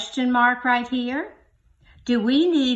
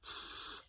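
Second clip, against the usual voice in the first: drawn-out s sound; 10 dB quieter than usual. Shown as a demonstration. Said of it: "and our sound power".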